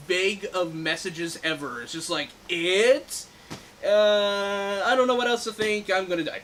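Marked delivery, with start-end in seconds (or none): 2.5-6.4 higher, louder mock voicing of a dying man; mock last words. Reviewer voicing Stan's last bathtub message, in a mock voice. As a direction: play a clueless last message, pitched up.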